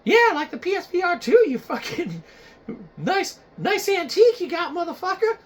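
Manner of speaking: high, whiny fan voice; a know-it-all viewer correcting the host; nitpicking viewer. Acted. Viewer correcting Shiza Box in a high whiny voice. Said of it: "You might be saying, like".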